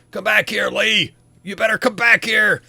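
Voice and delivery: big brute's high, loud yell; angry pursuer bellowing from behind; screaming. Acted. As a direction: shout after someone getting away. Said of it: "the big guy's kinda screaming".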